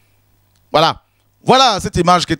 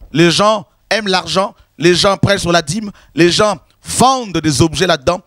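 ordinary talk

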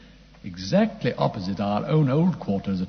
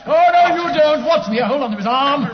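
shouting in a struggle; 10 dB louder than usual. Acted.

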